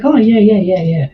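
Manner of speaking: mock enthusiastic yes; sarcastic yeah yeah. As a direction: fake eager agreement to a silly question. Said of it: "It's like".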